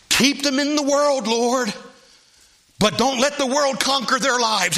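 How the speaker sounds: lifted high, pleading prayer voice. Acted.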